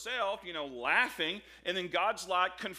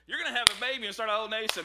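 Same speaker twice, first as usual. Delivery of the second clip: fast, louder, higher rush; announcing the news; 5 semitones higher, 7 dB louder, much faster than usual. Retold or imitated. Someone telling an old man about a baby, fast and excited. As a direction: blurt out the big news quickly. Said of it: "if you walk up to him".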